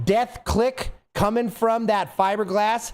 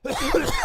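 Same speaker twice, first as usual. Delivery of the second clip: coughing fit. Real, not acted. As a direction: cough hard before speaking. Coughing fit during the monologue.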